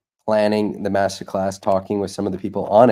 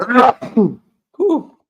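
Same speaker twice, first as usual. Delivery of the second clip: loud cough, then a breathy whew; coughing fit; 5 dB louder than usual. Real, not acted. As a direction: cough hard and exhale. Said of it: "Excuse me".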